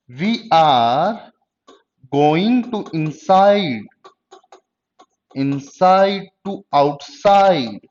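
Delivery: measured word-by-word delivery for note-taking; slow dictation. Real, not acted. Teacher dictates conditions to the class, slow dictation. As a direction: dictate slowly, with long pauses between phrases.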